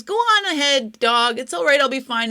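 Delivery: high, loud send-off; urging pig. A narrator's reading. The pig sends the loyal dog on ahead, loudly urging. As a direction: call it out to urge a friend onward.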